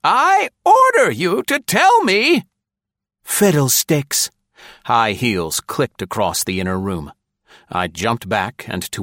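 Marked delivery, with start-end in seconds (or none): 0.0-2.4 very high, loud, slow neighing command; neighing voice rising. A narrator's reading. Colonel orders the woman to tell him, high and neighing. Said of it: "The man's voice went up a quarter octave". 3.2-4.3 higher, loud, slow scoffing retort; scornful woman. A narrator's reading. Woman dismisses the colonel, scornful.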